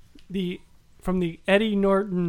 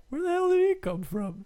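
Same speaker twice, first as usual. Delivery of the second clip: high-pitched puzzled viewer voice; mock bafflement; 10 semitones higher than usual. Panelist voicing a viewer lost by Doomsday, high baffled voice.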